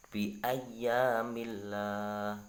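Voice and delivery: bi ayyamillah very slow with a light lam; very slow Quran demo; thin Allah. Shown as a demonstration. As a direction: recite it with tarqiq. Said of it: "dibaca tarqiq atau dibaca tipis".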